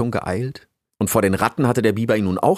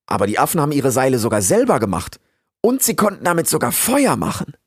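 higher, quick, enthusiastic report; eager traveller; 6 semitones higher, 4 dB louder than usual. Acted. Rix tells the beaver what apes made, eager and higher. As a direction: rattle off your discoveries excitedly.

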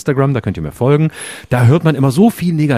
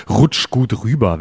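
slow, mocking parody voice; much slower than usual. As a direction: sneer out the cliché slowly. Mocking a hated New Year phrase.

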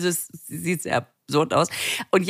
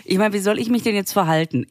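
usual speaking voice

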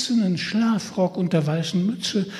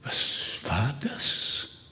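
frightened whisper; 8 dB quieter, slower than usual. Acted.

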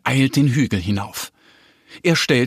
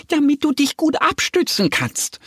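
very high duck voice handing over the poles; Annie helpfully; 14 semitones higher than usual. A narrator's reading. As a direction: offer the help brightly as the duck. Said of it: "sagt sie und nickt Mo zu". (hohe Entenstimme, hilfsbereit)

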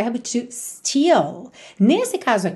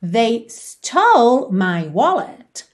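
very slow, rising on stole; slow model phrase; much slower than usual. Shown as a demonstration. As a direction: model it slowly for learners. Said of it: "How would you say that in English?". (frase modelo bem devagar, subindo em stole)

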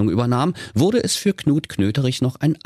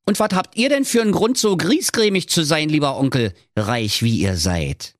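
high, bright nephew voice, briefly exasperated then happy again; a sunny nephew who won't be discouraged; high, cheerful young nephew; 9 semitones higher than usual. A narrator's reading. Nephew retorts.

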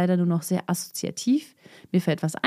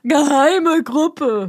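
drawn-out, higher mock proclamation; slow, exaggerated emphasis; mock announcement; 4 semitones higher, 3 dB louder, much slower than usual. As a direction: stretch each word out very slowly. Mock-announces the missed words.

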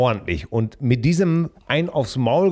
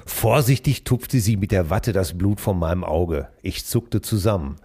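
low, hushed reading voice; story narration; 5 semitones lower than usual. Acted. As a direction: read it like a late-night audiobook. Continues reading novel aloud. (gedämpfte Vorlesestimme)

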